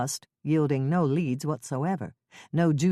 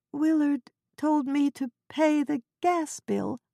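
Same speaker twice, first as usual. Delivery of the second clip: put-on Ethel's fretful old voice; 10 semitones higher than usual. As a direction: quaver it like a flustered old neighbor.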